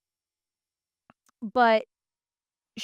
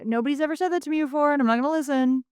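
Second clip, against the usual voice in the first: fast and high; 6 semitones higher, faster than usual. Retold or imitated. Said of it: "covers hers and is like".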